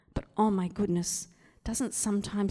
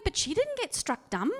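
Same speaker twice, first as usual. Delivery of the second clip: high, loud, incredulous protest; indignant objection; 8 semitones higher, 3 dB louder than usual. Retold or imitated. Preacher objecting to the story, high and incredulous. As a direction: spot the unfairness out loud.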